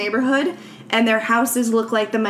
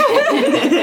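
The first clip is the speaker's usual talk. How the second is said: burst of laughter; 14 semitones higher, 4 dB louder than usual. Real, not acted. Laughing at her own story.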